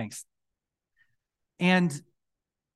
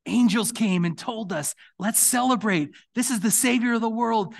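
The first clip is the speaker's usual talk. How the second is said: higher and louder, full of joy; excited shepherds; 8 semitones higher, 5 dB louder than usual. Acted. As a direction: call out the good news to celebrate. Shepherds arrive with the news.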